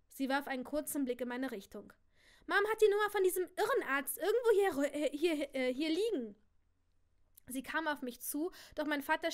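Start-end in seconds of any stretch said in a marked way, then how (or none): 2.5-6.4 loud, high-pitched jab; agitated scorn. Acted. Cousin suggests a psychiatrist for the narrator, agitated. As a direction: push it out loudly, with impatience. Cousin snipes about the madness doctor.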